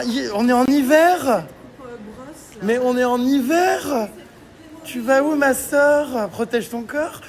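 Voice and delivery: high-pitched teasing scold; mock scolding. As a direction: scold her in jest. Teasing friend about her outfit.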